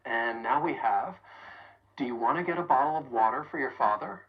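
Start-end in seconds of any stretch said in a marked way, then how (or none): 2.0-4.2 rapid, reductions running together; quick American reductions; fast connected speech. Shown as a demonstration. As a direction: rattle it off in one breath. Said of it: "Let's keep adding to that question".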